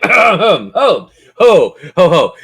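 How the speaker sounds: high, loud hammed-up villain laugh; gloating ho ho. Game master gloats over his natural twenty, hammed-up villain laugh. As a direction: cackle over the lucky roll.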